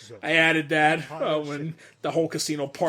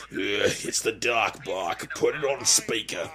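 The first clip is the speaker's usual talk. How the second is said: low, drawn-out character voice; slow grumbled put-on voice, pitched down; improvised character line; 5 semitones lower, slower than usual. Actor imitating another's improvised comic-dub line, low slow character voice. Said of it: "improvise that line where he's like".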